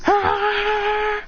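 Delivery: long high mock battle cry; drawn-out charging "ah"; power-up yell. Clone charging a Rasengan, high yell.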